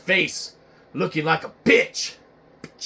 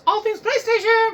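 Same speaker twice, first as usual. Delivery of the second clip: lightly mocked rival; 4 dB louder than usual. Rival voiced high.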